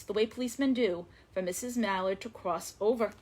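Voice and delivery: ordinary delivery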